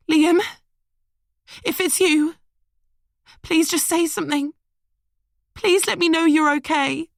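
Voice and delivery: high, loud desperate begging; pleading woman. A narrator's reading. Emily begging Liam over the phone. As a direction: beg him to answer.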